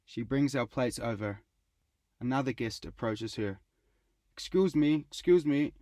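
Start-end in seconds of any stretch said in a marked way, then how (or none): 4.3-5.7 insistent louder call to get attention. A narrator's reading. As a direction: raise the voice to flag down a server. Guest calling to server.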